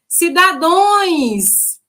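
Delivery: the unprepared candidate saying "Cidadões"; wrong plural. Retold or imitated. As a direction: drag out "Cidadões" like a confident wrong answer. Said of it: "vai dizer o quê?".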